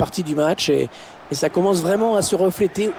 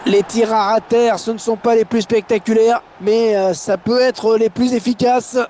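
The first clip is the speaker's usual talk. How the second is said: excited commentary; 7 semitones higher than usual. Real, not acted. Right after goal, still elevated.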